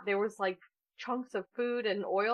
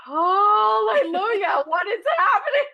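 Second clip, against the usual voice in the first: high, loud, slow laughing hallelujah; amazed laughing shout; 9 semitones higher, 8 dB louder, slower than usual. Retold or imitated. To husband on walking into the kitchen, loud laughing amazement. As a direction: cry out in delighted disbelief.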